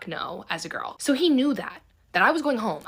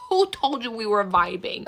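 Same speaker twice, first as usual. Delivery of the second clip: incredulous laugh; 3 dB louder than usual. Real, not acted. Laughing at his vibing claim.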